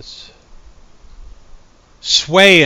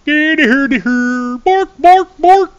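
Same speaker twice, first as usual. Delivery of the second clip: much higher, louder mock Swedish chef babble; 7 dB louder, slower than usual. Mocking a Swedish cop as the Swedish Chef. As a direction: bork it out like the Muppet chef. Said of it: "talk like the Swedish chef".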